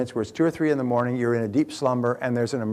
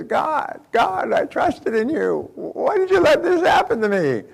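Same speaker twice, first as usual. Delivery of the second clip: much higher, drawn-out pleading wail; the last cry of a drowning man, slow and rising louder; desperate cry to God; 14 semitones higher, 5 dB louder, slower than usual. Acted. Drowning farmer cries to God.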